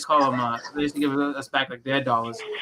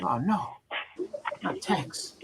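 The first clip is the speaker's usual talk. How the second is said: sheepish, whispered little "not tax"; mock meek denial; 5 semitones higher, 8 dB quieter, much slower than usual. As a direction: play innocent, voice dropping to a whisper. Acting meek reply to customs.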